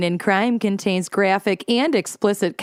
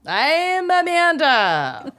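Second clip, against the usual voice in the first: high, slow and drawn out; 7 semitones higher, much slower than usual. Pretend. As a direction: stretch your name out up high. Odd put-on intro voice.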